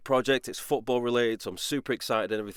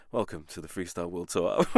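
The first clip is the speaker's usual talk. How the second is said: low, softer, flat-toned mock Eeyore read; depressed narration; 5 semitones lower, 5 dB quieter than usual. Mimicking his anxious episode two narration, lightly depressed. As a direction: drag it out like a gloomy donkey. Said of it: "'cause I was so anxious".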